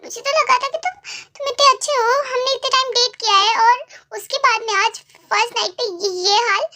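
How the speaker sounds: put-on young bride upset with her husband; hurt wife. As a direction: accuse him, hurt and disbelieving. Wife confronts husband after wedding night.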